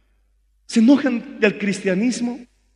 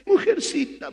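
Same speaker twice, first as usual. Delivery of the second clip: high mock offended "Mujercita"; lightly acted insult; mocking quote; 9 semitones higher than usual. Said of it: "¿Y qué te dicen?".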